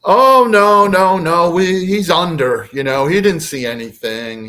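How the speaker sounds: hurried-excuse voice of an alien underling; tall gray covering up. Retold or imitated. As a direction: plead the excuse, starting high and loud. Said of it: "says back to the booming voice".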